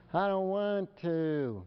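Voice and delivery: put-on high, drawn-out kid whine; imitating a whiny kid, slow and high; whining child. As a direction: drag the words out in a whiny protest. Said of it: "kids who whine".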